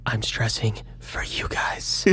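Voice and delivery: soft unvoiced whisper. Real, not acted. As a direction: whisper it as a joke aside. Joking aside.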